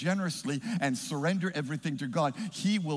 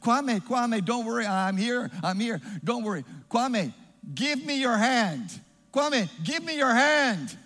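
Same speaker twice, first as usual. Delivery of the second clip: urgent calling out; 6 semitones higher, 4 dB louder than usual. Acted. Rescuer to drowning Kwame, urgent acted calling. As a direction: call to someone in the water, stretching the plea.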